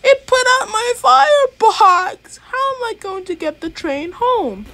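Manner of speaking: very high, loud panic; high loud panicked engine voice; panicked little engine. A narrator's reading. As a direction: cry out in alarm.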